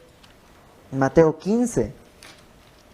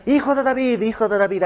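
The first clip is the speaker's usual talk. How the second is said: llamado agudo, atropellado e insistente; clamor hueco imitado; 6 semitones higher than usual. Retold or imitated. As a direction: parodia un grito dirigido a cualquiera. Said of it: "Ya no es". (clamor fingido, agudo y vacío)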